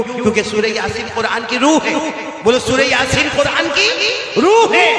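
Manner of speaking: high, loud sermon build-up with laughter; impassioned preacher pitch; fiery preaching. Acted. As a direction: rouse the crowd, rising to a cry. Preaching at full force.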